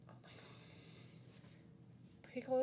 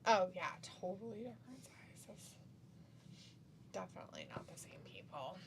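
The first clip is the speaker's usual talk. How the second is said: sarcastic whisper; 3 semitones lower, 5 dB quieter than usual. Real, not acted.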